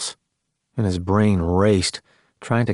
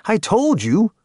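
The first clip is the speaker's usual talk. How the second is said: high-pitched exasperated protest; exasperated reminder; indignant demand; 12 semitones higher, slower than usual. A narrator's reading. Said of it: "Tex demanded".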